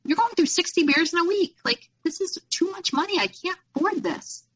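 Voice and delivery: high-pitched, fed-up retelling of her own protest; exasperated complaint. Retold or imitated. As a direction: replay your own exasperation at him, voice up high. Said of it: "I'm like".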